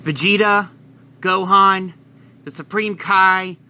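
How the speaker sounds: ordinary delivery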